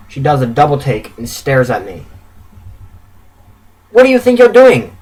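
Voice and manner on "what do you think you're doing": loud, high-pitched challenge; raised, scolding wife's voice; stern. A narrator's reading. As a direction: confront a husband with an outraged demand. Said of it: "she says sternly".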